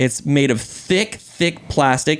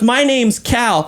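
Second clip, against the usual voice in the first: higher, slow mock child voice; indignant little kid; 6 semitones higher, slower than usual. Six-year-old telling the dad his name, slow and offended. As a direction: draw it out like an affronted small boy.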